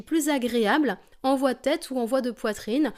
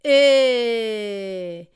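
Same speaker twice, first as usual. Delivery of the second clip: downward glissando; 7 dB louder than usual. Shown as a demonstration. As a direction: call out and let the pitch sink. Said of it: "on glisse vers le bas".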